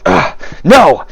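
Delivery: emphatic, swooping outraged refusal; indignant protest. Acted. Proud fighter refusing to be mesmerized by dwarf, indignant. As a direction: protest as if shaking off a spell. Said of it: "he'll stop and be like".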